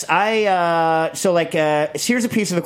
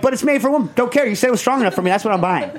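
indignant retort; 7 semitones higher, faster than usual. Retold or imitated. Acted exchange with shop.

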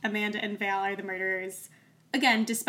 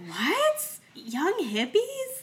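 incredulous; 9 semitones higher, much slower than usual. Retold or imitated. Incredulous reaction to the constable.

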